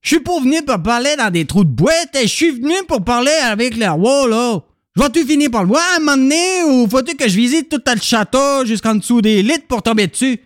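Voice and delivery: put-on annoyed Quebec accent. Visitor asking for the king, annoyed.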